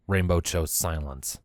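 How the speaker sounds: ordinary delivery, nothing marked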